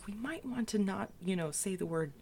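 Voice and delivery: hushed warning to a friend; whispering. Acted. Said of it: "just, like, whispering".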